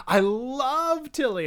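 high, loud, drawn-out adoration; gushing fan. Retold or imitated. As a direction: gush it slowly with big emphasis on love. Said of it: "I'm literally saying".

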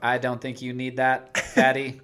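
smart fridge as a stiff robot; mock robot voice, evenly measured; robotic voice. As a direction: say it like a talking machine. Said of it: "Or, like, maybe less robotic voice".

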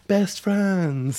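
very high, put-on cutesy voice; mock-gushy friendship. Mock bonding after the shared rhyme.

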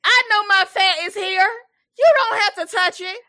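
loud, high outraged yell; mock outrage. At the church lady slapping her thighs, loud mock fury. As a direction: shout her off like she's had enough.

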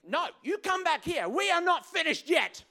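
high, loud mock-argument shout; angry demand. Arguer to the departing partner, angry shout. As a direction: yell it after someone walking out. Acted quote in a heated argument.

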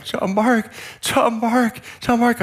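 high-pitched cry of relief, reenacted; relieved calling. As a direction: say the found child's name over and over with relief. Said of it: "it was the greatest relief".

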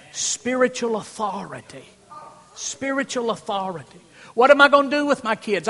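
loud, high-pitched sermon; full force preaching. Acted. Pastor urging parents to take authority, preached loud. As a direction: preach it hard to the congregation.

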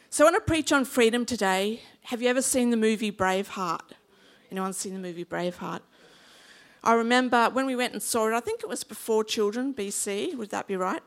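normal talking voice

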